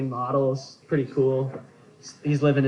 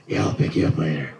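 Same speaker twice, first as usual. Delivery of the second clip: much lower gravel-growl date line; Clint Eastwood voice; 11 semitones lower than usual. Retold or imitated. Comic asking a woman out, low Eastwood growl. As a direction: growl it like a tough old cowboy. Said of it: "start talking like Clint Eastwood".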